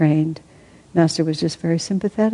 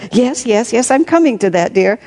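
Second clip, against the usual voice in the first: higher, hurried placating; hasty assurance; 6 semitones higher than usual. A narrator's reading. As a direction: rush to calm her, pitched up. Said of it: "he assured her hastily".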